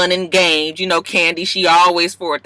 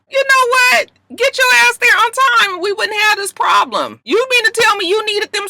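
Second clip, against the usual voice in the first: fed-up shout; 4 dB louder than usual. Real, not acted. Ranting at Nene's lateness.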